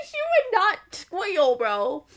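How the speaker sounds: high-pitched squeal through laughter; laughing. Real, not acted. Reader cracks up mid-sentence.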